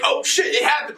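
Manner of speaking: mock surprise. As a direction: gasp it out like you just got caught. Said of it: "you're just like".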